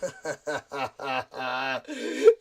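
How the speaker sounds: put-on chuckle climbing in pitch; scheming laugh. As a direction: laugh like a plotter behind the scenes. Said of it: "Ange Postecoglou is sat in the background going".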